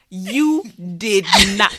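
loud, very slow "You did not"; emphatic denial. Real, not acted. Sister insisting on her version of events, slow emphatic denial of "You did not". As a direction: stretch out each word of "You did not". Repeats drawn-out contradiction.

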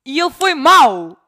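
loud, high outrage, slow on the first words; indignant cry of disbelief; outraged. Real, not acted. Telling her friend the driver was rude, loud outrage.

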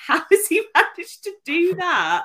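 high, loud disbelieving cry; shocked and amused at the dog; astonished. Retold or imitated. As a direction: act out being stunned by the mess. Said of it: "we were just like".

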